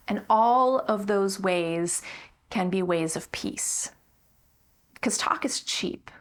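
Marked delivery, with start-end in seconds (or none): 5.0-6.1 a sudden hushed whisper. Acted. Preacher whispering to the congregation. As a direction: drop to a whisper for effect.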